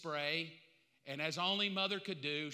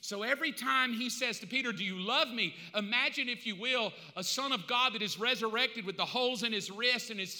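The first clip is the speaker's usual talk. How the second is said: loud, driving sermon delivery, pitch raised; full-force preaching; 5 semitones higher, 8 dB louder than usual. Acted. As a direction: build the scene in urgent, pounding phrases.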